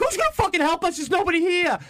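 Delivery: very high-pitched exasperated panic; retold freak-out. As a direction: snap back in acted desperation. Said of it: "you're just freaking out".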